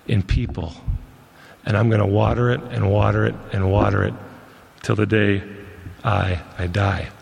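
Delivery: low, measured vow with pauses between phrases; solemn preaching vow. Real, not acted. Preacher vowing to keep seeking God's seeds till death, solemn.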